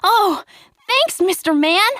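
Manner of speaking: high, loud happy thanks; grateful boy. Acted. Pinocchio thanking the puppet master, high and loud. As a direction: thank him with delight.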